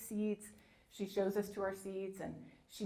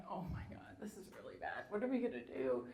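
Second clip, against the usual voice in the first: whispered childhood panic, barely voiced; frightened whisper to her parents; panicked whisper; 6 dB quieter than usual. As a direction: hush it out like a scared little kid. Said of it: "and I'm like".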